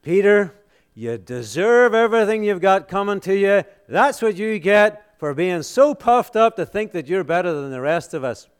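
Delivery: hammed-up rebuke, high and loud; scornful scolding. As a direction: tell him off with acted contempt. Acting apostles scornfully disowning Peter.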